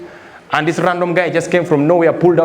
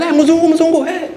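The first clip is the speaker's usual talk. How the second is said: higher shouted street call; 9 semitones higher than usual. Retold or imitated. Boda riders calling out.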